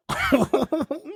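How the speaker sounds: real high laugh; short squeaky laugh. Real, not acted.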